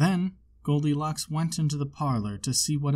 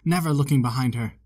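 usual speaking voice

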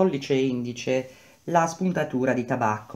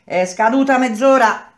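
raised call across the site; 9 semitones higher, 6 dB louder than usual. Acted. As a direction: shout it to reach every corner. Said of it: "gridò Madì".

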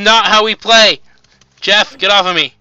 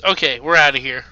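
ordinary talk, nothing marked

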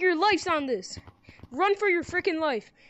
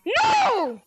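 shout; 7 semitones higher, 5 dB louder than usual. Real, not acted. Player going the wrong way.

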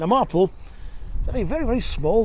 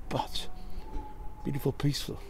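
whispering; 11 dB quieter than usual. Real, not acted. Savouring quiet scenery.

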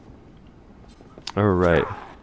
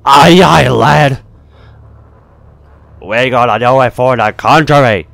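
hearty rallying shout; 2 semitones higher, 7 dB louder than usual. Pretend. Rallying cheer in game.

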